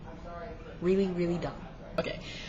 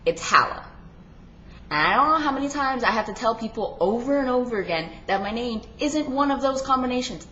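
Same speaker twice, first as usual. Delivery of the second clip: ordinary delivery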